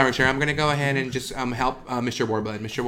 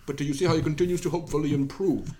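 ordinary talk, nothing marked

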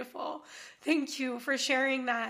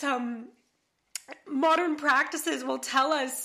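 usual speaking voice

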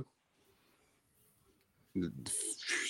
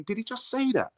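high, softer incredulous thought; stunned disbelief; 5 dB quieter than usual. Retold or imitated. Player thinking about coach's remark, stunned disbelief. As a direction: voice a stunned inner reaction.

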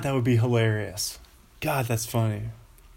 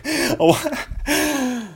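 laughing; 4 dB louder, much slower than usual. Real, not acted. Laughs at own confession.

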